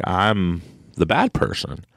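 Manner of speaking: usual speaking voice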